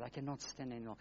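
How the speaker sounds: said softly, almost under the breath: "I cannot stand anymore"; a weary, hushed "I cannot stand anymore"; quiet resignation. Retold or imitated. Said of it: "And I said".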